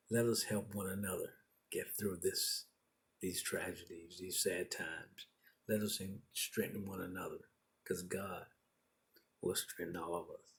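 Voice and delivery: soft, low, tender closing words; hushed, heartfelt send-off; gentle encouragement. Real, not acted. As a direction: wind down quietly, consoling the listeners.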